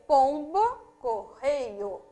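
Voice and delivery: "pombo, correio" split in two, POM and REI each stressed; two stressed syllables. Shown as a demonstration. Teacher showing two tonic syllables, "pombo, correio" stressed separately. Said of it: "Existem duas sílabas tônicas".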